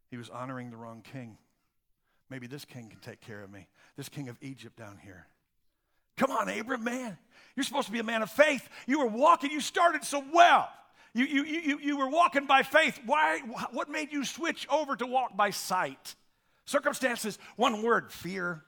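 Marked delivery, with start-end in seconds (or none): 2.3-5.3 low, soft musing; private reasoning. Acted. Preacher as Abram eyeing Egypt's king, quiet and low. 6.2-16.2 high, rising appeal, loud at the peaks; exasperated pleading. Acted. Preacher confronting Abram over his lost faith, exasperated and high-pitched.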